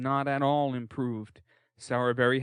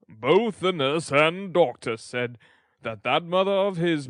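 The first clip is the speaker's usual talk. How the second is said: louder, pompous lecturing beadle; 5 dB louder than usual. A narrator's reading. Beadle advising the undertaker's wife, loud pompous lecture. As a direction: hold forth with self-importance. Bumble pontificating.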